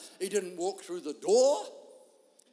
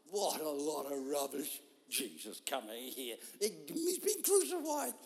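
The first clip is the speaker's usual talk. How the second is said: scoffing Thomas; 6 semitones lower, 6 dB quieter than usual. Retold or imitated.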